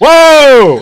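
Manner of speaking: long, very high, loud whoa; excited shout. Real, not acted. Streamer reacting to the fight announcement, thrilled shout. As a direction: holler in amazement.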